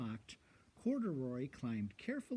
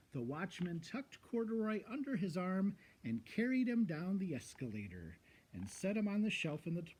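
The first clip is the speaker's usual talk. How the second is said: usual speaking voice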